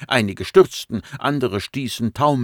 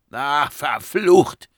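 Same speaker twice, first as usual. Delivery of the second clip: slow, loud high curse; stretched, raised-pitch wail of dismay; crying out; 7 semitones higher, 6 dB louder, much slower than usual. Acted. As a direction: cry out a drawn-out curse. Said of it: "rief Monin".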